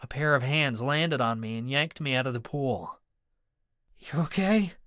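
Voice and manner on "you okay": concerned friend. A narrator's reading. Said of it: "Marcus asked".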